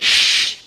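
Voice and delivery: long hissed shush. Acted. Everyone silencing Dave, shushing. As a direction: hush them with a drawn-out shh. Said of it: "so everyone said".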